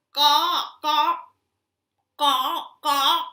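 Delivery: loud cawing like a crow; loud crow caws. Acted. Storyteller cawing loudly to the children. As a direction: belt out harsh caws.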